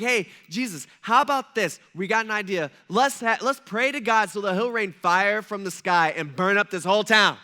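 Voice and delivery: loud, eager pitch of a bad idea. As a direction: sell the fiery plan with gusto. Disciples eagerly proposing fire from heaven.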